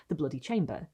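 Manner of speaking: ordinary delivery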